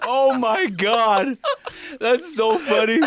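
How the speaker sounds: high, slow, laughing hard; cracking up mid-sentence. Real, not acted.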